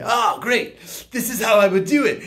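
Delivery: high, loud and fast with a laugh; laughing keen answer to the boss; eager excitement. Retold or imitated. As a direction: rush it out, delighted to explain. Eager employee quoted.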